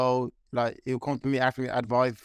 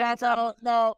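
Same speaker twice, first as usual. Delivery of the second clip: catchphrase hype; 10 semitones higher than usual. Acted. Fighter gives his catchphrase on request, hyped up. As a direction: belt out the catchphrase. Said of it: "you're gonna give me a".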